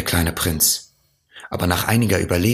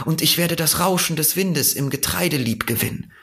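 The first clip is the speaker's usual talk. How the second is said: higher, lilting fox voice; earnest fox; 9 semitones higher, faster than usual. A narrator's reading. Fox tells the prince what taming would mean, earnest and wistful. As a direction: plead the case gently as the fox.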